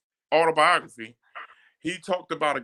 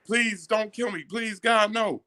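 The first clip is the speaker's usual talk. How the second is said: higher, half-hearted imitation of a crying man begging for his life; mock pleading; 9 semitones higher, slower than usual. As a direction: beg like the victim, lightly voiced, not truly crying. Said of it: "really praying and crying".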